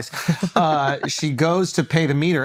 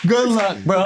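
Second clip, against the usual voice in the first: mock meter maid; 4 semitones higher than usual. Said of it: "he goes".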